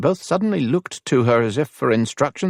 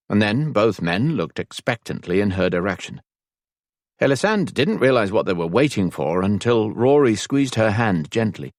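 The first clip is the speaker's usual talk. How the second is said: ordinary delivery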